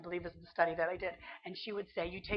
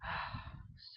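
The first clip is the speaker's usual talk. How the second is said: long, soft, unvoiced sigh; weary sigh; 10 dB quieter than usual. Real, not acted. Tired sigh after quoting the Spirit's gentle rebuke. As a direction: let the breath go heavily. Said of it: "So hard".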